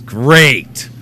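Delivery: ordinary delivery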